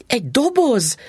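high startled question; wife exclaiming at the mention of a box; surprised cry. Acted. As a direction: jump up high in disbelief. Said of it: "kiáltott fel Mrs".

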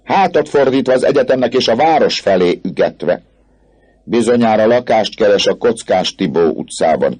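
mocking student's cry; shouted taunt. A narrator's reading. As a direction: call the joke out over the crowd. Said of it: "kiáltotta Jean de Moulin".

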